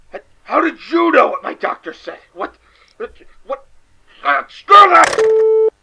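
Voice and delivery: high, loud screaming panic; terrified yell. Acted. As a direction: shriek it and bolt.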